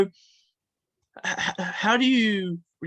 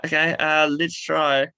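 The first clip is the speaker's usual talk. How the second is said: usual speaking voice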